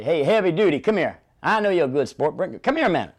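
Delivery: loud, high teasing call; barker call-out. Retold or imitated. Barker calling a heavy man over to his game. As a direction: josh the big guy over, loud and friendly. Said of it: "I would say".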